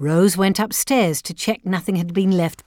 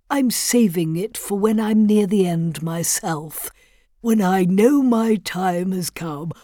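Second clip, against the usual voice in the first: lightly voiced grieving old widower; 2 semitones higher than usual. A narrator's reading. Voices the old father-in-law.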